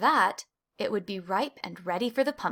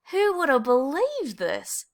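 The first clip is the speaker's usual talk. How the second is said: slow, higher, happy marvel; Desmond rejoicing; 5 semitones higher than usual. A narrator's reading. Desmond dancing at the prize, slow happy marvel. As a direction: savour the surprise.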